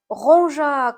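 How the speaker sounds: usual speaking voice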